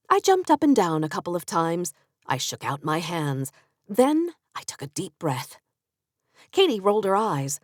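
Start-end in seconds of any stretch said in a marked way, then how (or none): none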